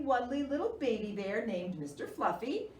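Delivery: usual speaking voice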